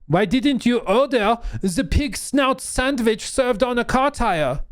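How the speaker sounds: indignant French chef, slow and high-pitched; hammed-up Pink Panther French accent; bad French accent. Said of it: "my French accent always turns into Steve Martin".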